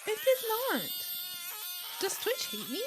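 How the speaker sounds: high fake-crying shout; hammed-up sob; mock wail. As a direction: wail in disappointment. Disappointed outburst.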